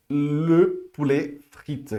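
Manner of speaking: le poulet frite drawn out very slowly; extra slow model of le poulet frite; slow repeat. Shown as a demonstration.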